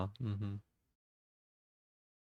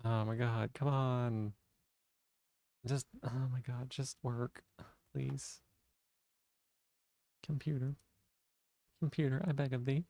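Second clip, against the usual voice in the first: quiet pleading; 6 dB quieter than usual. Real, not acted. Begging the crashed computer quietly.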